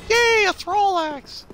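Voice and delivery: high slow gleeful cry; delighted squeal. Real, not acted. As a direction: squeal over the loot.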